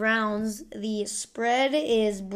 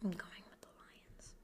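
hushed, embarrassed whisper of the pick; sheepish whisper; 5 semitones lower, 22 dB quieter than usual. Real, not acted. To listeners, admitting an unpopular pick in a whisper. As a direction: whisper it like a guilty confession. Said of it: "I'm sorry".

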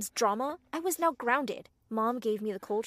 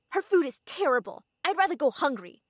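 loud, higher defiant protest; screaming; 5 semitones higher, 9 dB louder than usual. A narrator's reading. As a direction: shout your complaint. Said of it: "I screamed".

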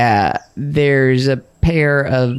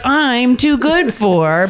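snobby; 7 semitones higher, much slower than usual. Retold or imitated. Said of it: "that you're like".

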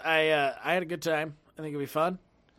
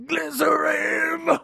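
shout; 11 semitones higher, 6 dB louder, much slower than usual. Acted. Shouted exclamation.

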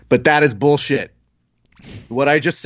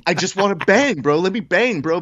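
higher-pitched frustrated plea; frustrated athlete; 6 semitones higher than usual. Acted. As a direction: plead like an athlete itching to fight. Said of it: "frustration of every athlete being like".

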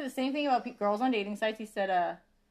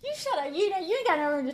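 playful shut up; 8 semitones higher than usual. Real, not acted. Playful retort high.

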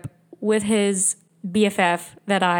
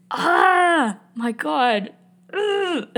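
exasperated groan; 7 semitones higher, much slower than usual. Real, not acted. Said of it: "reacting to his messages".

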